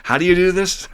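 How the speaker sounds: high, squeaky what-the-heck reaction; incredulous newcomer hearing the rules; baffled disbelief. Retold or imitated. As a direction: act completely thrown by the idea. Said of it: "And I'm like".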